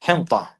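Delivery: حنطة said as "hinta"; isolated حنطة with its extra n sounded; added nun. Shown as a demonstration. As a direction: say حنطة alone, n before the t. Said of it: "They came up with the word".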